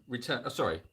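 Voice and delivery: ordinary delivery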